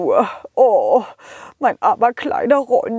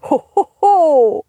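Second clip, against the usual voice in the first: Santa laugh; 5 semitones higher, 3 dB louder than usual. Acted.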